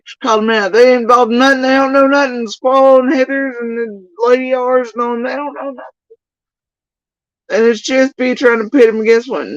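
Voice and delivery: high mocking sing-song of their claims. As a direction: parody them in a high voice.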